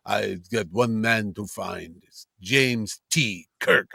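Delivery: low, slow, deliberate villain's voice; recorded log voice. Retold or imitated. Co-host imitating the villain's log from the trailer, low and slow. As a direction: draw it out low with weighty pauses. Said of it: "you hear his voice".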